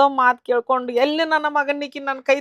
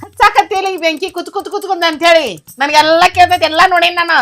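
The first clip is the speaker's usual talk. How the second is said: loud, higher, indignant accusation; angry accusation; 5 semitones higher, 6 dB louder than usual. Acted. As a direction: rail at her for stirring up trouble. Mother accuses daughter-in-law of stirring trouble.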